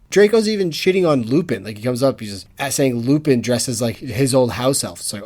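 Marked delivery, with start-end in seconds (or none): none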